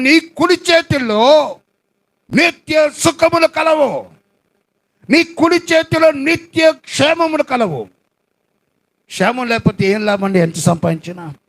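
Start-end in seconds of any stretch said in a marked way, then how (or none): 0.0-7.9 slow, raised preaching of the verse; scripture reading. Acted. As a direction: proclaim the verse slowly and high. (నెమ్మదిగా, ఎత్తైన స్వరంతో వాక్య పఠనం)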